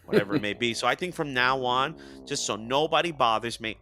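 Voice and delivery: ordinary talk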